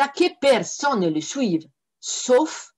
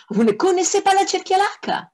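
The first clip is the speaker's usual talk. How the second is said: much higher, amazed disbelief; astonished exclamation; 13 semitones higher, 3 dB louder than usual. Acted. Saint feigns shock at the devils, high and astonished. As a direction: gasp it like you can't believe it. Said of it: "s'exclama Saint-Antoine avec stupeur".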